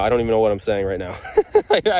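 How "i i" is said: high burst of laughter; giddy laugh. Real, not acted. Detectorist overwhelmed by his find, giddy high laugh. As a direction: laugh out of pure disbelief. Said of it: "I can't even think".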